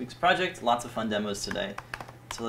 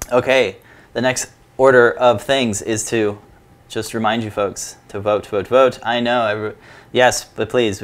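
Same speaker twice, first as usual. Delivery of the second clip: louder, pressing hard; urgent and loud; 11 dB louder than usual. Real, not acted. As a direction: push them to go vote. Urging viewers to vote.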